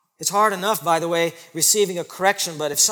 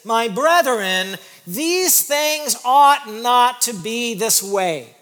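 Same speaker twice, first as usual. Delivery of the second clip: drawn-out, weighty scripture reading; slow solemn quote; 6 semitones higher, 6 dB louder, much slower than usual. Acted. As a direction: lean hard on ought. Quoting James slowly.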